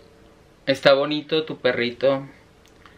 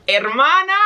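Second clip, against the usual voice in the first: high laughing "hermana". Real, not acted. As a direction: burst out laughing. Laughing greeting to chat partner.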